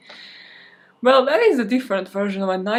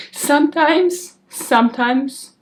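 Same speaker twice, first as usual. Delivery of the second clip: 1 drawn-out emphasis; 4 semitones higher, 6 dB louder, slower than usual. Shown as a demonstration. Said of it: "preaching her motto slowly".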